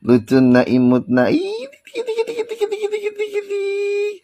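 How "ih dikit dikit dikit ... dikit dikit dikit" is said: very high, soft squeak; squeaky baby voice. Pretend. Vlogger mocking lawyer in baby squeak. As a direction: tease in a tiny falsetto.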